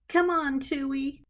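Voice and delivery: high, sing-song coaxing; coaxing plant. A narrator's reading. Clarissa coaxing her aloe plant Tui, high and playful. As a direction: talk to the plant like a pet.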